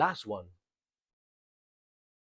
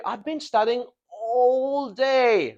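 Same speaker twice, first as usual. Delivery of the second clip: ALL DAY high, loud and drawn out; emphasis on duration; 10 semitones higher, 5 dB louder, slower than usual. Shown as a demonstration. Teacher showing how long the studying lasted, duration emphasized. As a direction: stretch "all day" slowly at a raised pitch. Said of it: "The emphasis is on the duration".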